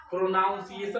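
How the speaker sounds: "प्रोनाउंसिएशन" with "noun" as second syllable; mispronounced like pronoun. Retold or imitated. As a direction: say "प्रोनाउंसिएशन" as pro-NOUN-si-e-shun. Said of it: "प्रोनाउंसिएशन बोलते हो तुम लोग ना".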